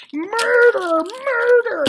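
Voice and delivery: high, loud shrieked murder; building mock yell; mock screaming. Real, not acted. Escalating murder chant.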